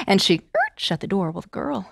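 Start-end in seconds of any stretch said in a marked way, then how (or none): none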